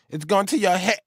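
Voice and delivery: high-pitched line chopped at "head"; line cut off. Shown as a demonstration. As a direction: break off abruptly on "head", as if shot. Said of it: "should be cut off because remember he gets shot".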